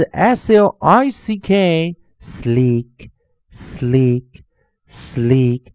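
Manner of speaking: letters spelled out, then "slack" said slowly three times; slow spelling drill. Shown as a demonstration. Teacher leading students through new words, slow drill. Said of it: "同学们跟着老师练习一下".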